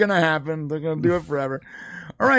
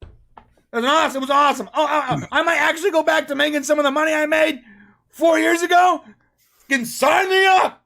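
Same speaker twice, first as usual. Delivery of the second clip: higher-pitched mock delight; sarcastic glee; 7 semitones higher than usual. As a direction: gush sarcastically about the great night. Sarcastically mocking the terrible night.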